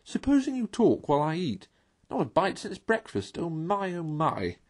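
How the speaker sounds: high, half-whispered mole voice; eager for his food; hungry Mole. Acted.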